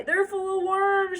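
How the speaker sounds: put-on high, loud, slow sobbing child; high wailing little girl; mock sobbing. A narrator's reading. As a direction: wail it through pretend tears. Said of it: "she sobbed".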